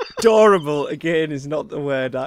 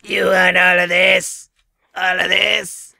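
hammy shout; 2 semitones higher, 4 dB louder, slower than usual. Acted. Hammed-up seduction bit.

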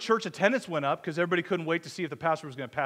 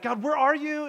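a raised, pleading cry to God; anguished cry; 9 semitones higher, 4 dB louder, slower than usual. Retold or imitated. Pastor recalling his cry to an absent God, pleading. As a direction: call out to God, feeling unheard.

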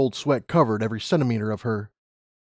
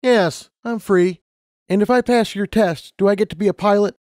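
high, louder hopeful applicant; Lumina, eager; 9 semitones higher, 5 dB louder than usual. A narrator's reading. Lumina asking interviewer for pilot job, high eager voice. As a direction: lift pitch and volume with hope.

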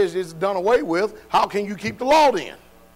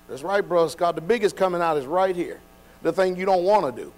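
ordinary talk, nothing marked

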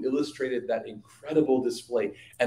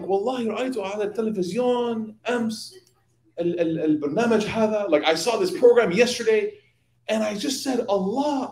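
higher, amazed voice quoting the scholar; astounded retelling; 6 semitones higher, faster than usual. Retold or imitated. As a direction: relive his wonder at the hummingbird. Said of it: "he was astounded by the hummingbird".

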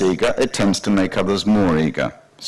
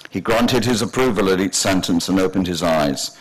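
plain reading voice

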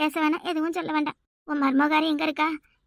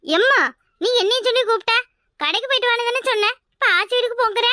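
whiny protest; 6 semitones higher than usual. Acted. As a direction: push back like a sulky kid. Daughter protests the errand.

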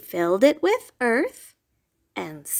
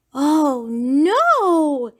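loud dismayed cry, pitched up; moaning; 5 semitones higher, 5 dB louder than usual. Acted. Rabbit moaning over his missing shamrocks. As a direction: wail it in dismay. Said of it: "he moaned".

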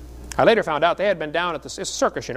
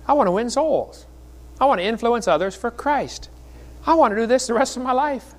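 higher-pitched, impassioned retold prayer; fervent, pleading declaration; earnest vow; 5 semitones higher than usual. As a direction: re-enact pledging your life in prayer. Acted heartfelt vow to God.

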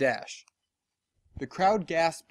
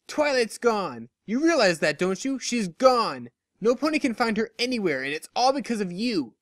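higher, bitter blaming voice; accusing friend; 6 semitones higher than usual. Acted. Dash blaming Lyra for Twilight's disappearance, bitterly accusing. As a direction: pin all the blame on her.

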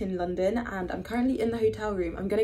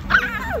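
high squeal into laughter; excited squeal; 12 semitones higher, 7 dB louder than usual. Real, not acted. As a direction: shriek with joy, then laugh. Arriving at venue excited.

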